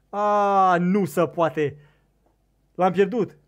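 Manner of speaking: loud cry of disbelief; dismayed shout. Real, not acted. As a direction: shout out the loss, dragging the first cry. Fish is lost.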